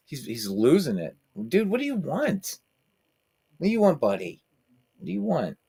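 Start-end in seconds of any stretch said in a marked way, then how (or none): 1.5-5.6 pet voice. Real, not acted. Asking the wild cat what it wants.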